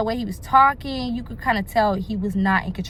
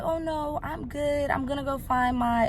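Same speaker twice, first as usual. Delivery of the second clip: soft, higher, casual brush-off; calm exit; 5 semitones higher, 6 dB quieter than usual. Retold or imitated. Woman easing away from a drunk man, calm and soft. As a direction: beg off lightly and softly. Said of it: "in the most calm way".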